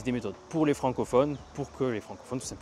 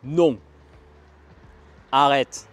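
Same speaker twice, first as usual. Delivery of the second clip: demonstrated authoritative command; 2 semitones higher, 12 dB louder, much slower than usual. Shown as a demonstration. Teacher showing authority as a trick for the fourth tone, authoritative. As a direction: give a firm order, much louder, with a pause between. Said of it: "ça va être plus autoritaire".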